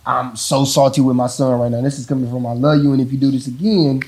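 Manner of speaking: ordinary delivery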